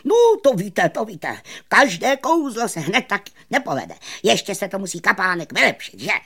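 high, loud, hurried excuses; blustering magician. A narrator's reading. Magician excusing a botched trick, loud high bluster. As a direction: bluster through it, speeding up at the end. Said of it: "přitom halasil".

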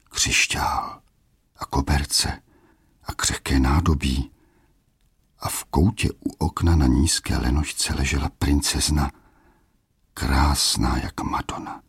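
hushed, breathless whisper of wonder; whispered in amazement; awed whisper. A narrator's reading. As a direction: breathe the words as if afraid to wake her. Narrator awed by princess's room.

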